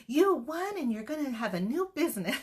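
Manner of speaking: put-on high, loud excited kid voice that speeds up at the end; excited grandson. The grandson telling his grandma she won, high and thrilled. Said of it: "my grandson goes".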